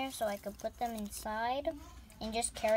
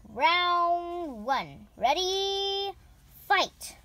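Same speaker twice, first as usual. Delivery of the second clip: booming fighting game announcer; 5 semitones higher, 6 dB louder, much slower than usual. Pretend.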